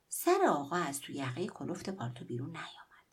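ordinary delivery

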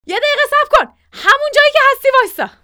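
high, loud panicked yell; shouting at animals. A narrator's reading. Kaka yells at the charging goats, shouting. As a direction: yell out sharply to halt them. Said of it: "سر حیوونا داد کشید".

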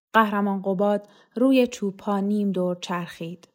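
usual speaking voice

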